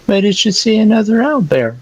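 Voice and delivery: very high character voice; agitated questioning. Acted. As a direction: demand it in a high-pitched squawk. Character demands an answer, alarmed.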